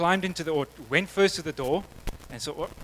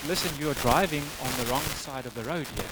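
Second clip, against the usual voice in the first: softer, measured, word-by-word cop; acted patient repetition; 5 dB quieter than usual. Cop repeating himself to the disbelieving driver, soft and deliberate. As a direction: spell it out quietly for someone not getting it.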